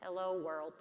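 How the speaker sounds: a hint of machine, evenly spoken; computer voice. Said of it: "It's my best computer voice".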